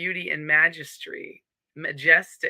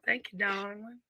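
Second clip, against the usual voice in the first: put-on Southern accent; 4 semitones higher, 8 dB quieter than usual. Host thanking a commenter who loves the accent, playful Southern accent. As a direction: lay on a light Southern accent, softening the thanks. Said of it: "Love the Southern accent".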